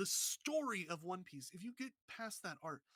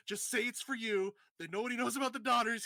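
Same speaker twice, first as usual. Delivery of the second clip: louder, higher urging; urgent pleading; 4 semitones higher, 8 dB louder than usual. Retold or imitated. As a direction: push her to save herself, urgently. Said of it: "the guy's just like".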